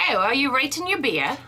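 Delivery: subtler English accent. Shown as a demonstration. Co-host's corrected take on the Englishwoman's question, English accent. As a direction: ask it politely in an English accent. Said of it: "it wasn't condescending".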